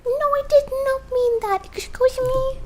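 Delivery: high indignant no, I did not; high, offended voice snapping back; indignant retort. Retold or imitated. Talking back to the drawing program, high and offended. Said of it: "And I'm like".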